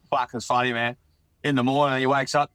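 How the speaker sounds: ordinary delivery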